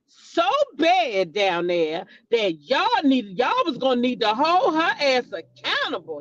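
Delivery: ordinary talk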